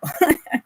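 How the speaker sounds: short, high burst of laughter; a quick amused giggle at herself. Real, not acted. Giggle after own story.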